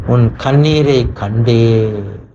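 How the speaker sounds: very slow, every word drawn out; slowed-down tender promise; slow emphatic. Acted. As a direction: linger on each word as a promise. Preacher voicing God's tender promise.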